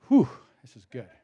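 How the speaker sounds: winded, rushed phrase ending in a big exhale; out of breath. Real, not acted. Preacher losing their breath on a long point about God, winded. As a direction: run out of breath by the end, then puff out a whew. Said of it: "Lost my breath on that one".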